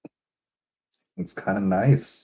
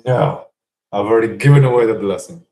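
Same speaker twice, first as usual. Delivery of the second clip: loud, fast, hammed-up refusal; mock exasperated Isaac; 3 semitones higher, 8 dB louder than usual. Isaac comically refusing Esau another blessing, loud and fast. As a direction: brush him off in a comic rush. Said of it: "Isaac's like".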